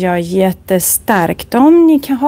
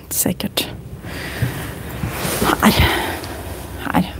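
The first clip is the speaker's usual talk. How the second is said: whisper; 10 dB quieter than usual. Real, not acted. Whispered aside while showing books.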